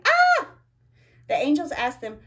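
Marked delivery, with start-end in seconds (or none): 0.1-0.5 short high loud scream; mock scream. The frightened women at the tomb, high mock scream. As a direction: let out the women's startled scream. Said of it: "They screamed anyway".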